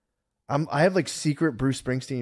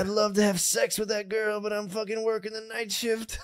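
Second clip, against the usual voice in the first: mock worker; 8 semitones higher, slower than usual. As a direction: mutter like a tired night worker. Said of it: "retold self in the van".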